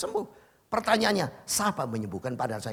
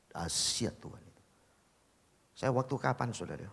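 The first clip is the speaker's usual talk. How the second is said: usual speaking voice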